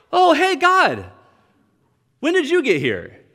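high, loud burst of sudden recognition; mock surprised greeting. Believer suddenly notices God, comically surprised. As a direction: play someone startled by an unexpected arrival.

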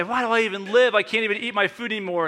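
high-pitched mock complaint of an old man; mock lament. Great-grandfather's comic lament retold.

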